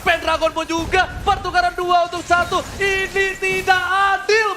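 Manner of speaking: rising, shouted esports call; excited play-by-play. Acted. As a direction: call the fight like a hyped caster. Hyped live esports call.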